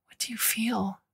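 soft whispered question; a hushed, tender whisper; asked quietly. Acted. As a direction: ask it gently, almost unvoiced. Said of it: "Jasmine asked quietly".

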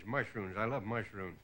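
low, soft dopey mumble; dopey drunk Dean. Retold or imitated. Comic mimics Dean answering about mushrooms, low dopey mumble. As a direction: play him slow-witted and blissful.

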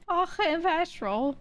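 slow, high mock accent with airy "Fucking"; drawn-out accent imitation; imitating Apple's pronunciation. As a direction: stretch "Fucking petrol" out, air rushing through it. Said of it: "where is it coming from".

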